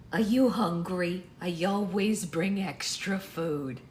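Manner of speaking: put-on slow, drawn-out old lady; grandma voice. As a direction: offer food slowly as a grandmother. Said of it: "this is just the regular grandma voice".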